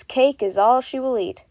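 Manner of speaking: ordinary talk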